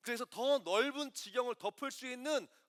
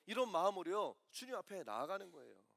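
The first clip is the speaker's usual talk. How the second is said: ordinary talk, nothing marked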